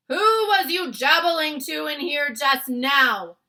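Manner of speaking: loud, high, slow boom; booming giant. Acted. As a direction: boom the question down at him. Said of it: "he boomed".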